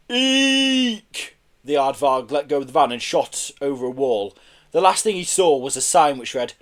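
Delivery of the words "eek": long, high squeal; loud eek. A narrator's reading. Aardvark losing his grip on the van, squealing. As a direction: squeal in alarm. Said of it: "With a loud".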